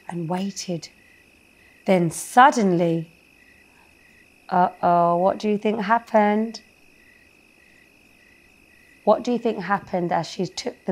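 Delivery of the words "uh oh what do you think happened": ordinary delivery, nothing marked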